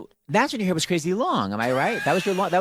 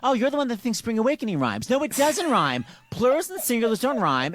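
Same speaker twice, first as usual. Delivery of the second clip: ordinary talk, nothing marked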